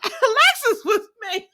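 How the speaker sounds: laughing hard, voice squeaking high; cracking up at Alexis. Real, not acted. Laughing to viewers about Alexis's shock.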